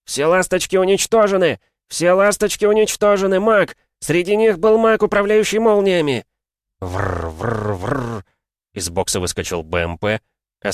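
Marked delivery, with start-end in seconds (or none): 0.1-1.6 frantic soldier yelling the alarm; panicked shout. Acted. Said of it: "с громким криком солдата". 1.9-6.3 frantic soldier yelling the alarm; panicked shout. Acted. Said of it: "с громким криком солдата". 6.8-8.3 engine rumble. Acted. Engines roaring, imitated.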